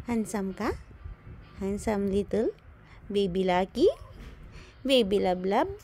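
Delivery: low put-on voice speaking for the baby; voicing the baby. Voicing the baby's reply, low and mock-serious. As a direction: answer for the baby in a deep mock voice.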